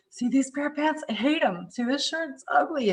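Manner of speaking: hammed-up grumbling over old clothes; a self-mocking imitation of a petty complaint; whiny complaining client. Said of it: "open it up and going".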